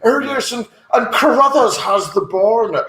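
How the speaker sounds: high, plummy upper-class announcer; posh commentator. Acted. Posh sports commentator calling polo. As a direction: call the match like a rich toff. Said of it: "nose artificially elevated".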